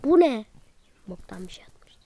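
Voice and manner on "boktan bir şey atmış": a muttered whispered put-down; whispered aside. Real, not acted. Kid muttering about a bad video, whispered. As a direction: whisper it under the breath.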